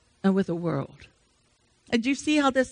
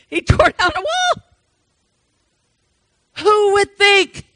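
rising amazement; 6 semitones higher than usual. Acted. Preacher to church, amazed and climbing higher. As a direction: marvel at the fall of the wall.

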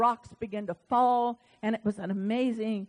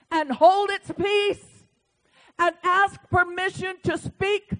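louder, higher preaching build; full-force preaching; 7 semitones higher, 7 dB louder than usual. Acted. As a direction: drive it home like a revival preacher.

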